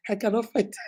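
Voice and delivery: laughing through the words. Real, not acted. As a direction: admit it through real laughter. Amused at her own lack of strength.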